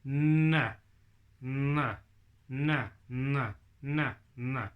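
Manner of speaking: "N" with the tongue behind the front teeth; n sound demo. Shown as a demonstration. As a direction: repeat "n" with the tongue at the teeth ridge. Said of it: "listen to the difference there".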